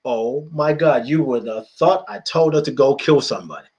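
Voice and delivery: ordinary delivery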